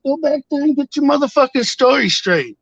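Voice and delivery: high, furious retold yell; angry yell. Yelling at the cops holding him at gunpoint, furious. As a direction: bark it at the cops at full pitch.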